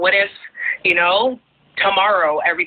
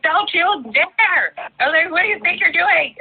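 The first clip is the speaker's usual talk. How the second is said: mock scolding; 12 semitones higher than usual. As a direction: play the outraged daughter, pitch flying up. Scolding dad about New York.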